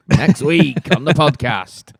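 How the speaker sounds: loud, slow podcast trailer voice; big drawn-out mock announcement; mock announcer. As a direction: announce it like a hammed-up teaser. Mock trailer for next episode.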